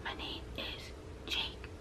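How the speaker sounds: faint unvoiced whisper, slow. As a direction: breathe the name out like a shy student. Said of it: "'cause you're whispering".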